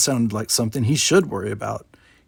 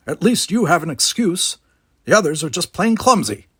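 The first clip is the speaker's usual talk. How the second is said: put-on grumpy old man; 6 semitones higher, 5 dB louder than usual.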